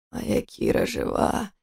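тихий медленный шёпот; прошептать себе, отгоняя призрак. Acted. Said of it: "прошептал он". (шёпотом, медленно)

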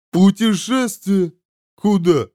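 high, drawn-out questioning; dismayed glutton demon. A narrator's reading. Demon hears a journey awaits, dismayed. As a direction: stretch the word out in dismay.

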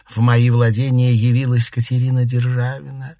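ordinary delivery, nothing marked

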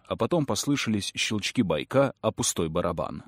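plain reading voice

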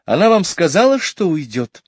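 high, loud, distressed mother voice; a flustered lady breaking bad news; upset mother. A narrator's reading. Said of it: "удивилась мама".

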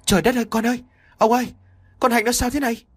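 high, frantic mother calling for help; a mother's alarmed high wail; panicked cry. Acted. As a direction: shriek it out in sudden terror. Mother finds daughter collapsed.

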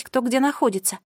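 usual speaking voice

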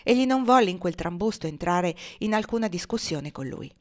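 usual speaking voice

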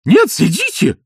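high, loud cry; shout. Acted. Изнуренков shouting to stop the girl, high loud. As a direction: shout to stop her standing. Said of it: "закричал Изнурёнков".

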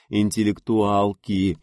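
slow, drawn mock of the word; mild peasant disdain; superior snort. A narrator's reading. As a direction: scoff at the brainy types. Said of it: "с крестьянским превосходством фыркнула Инна".

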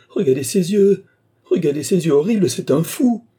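high, loud horrified outburst; panicked cry. A narrator's reading. Mary clutches her fiancé at the madman's eyes, panicked. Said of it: "En proie à une panique soudaine".

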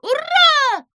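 loud high cry of hurrah; cheering shout. A narrator's reading. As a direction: everyone cheering at once. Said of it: "закричали все".

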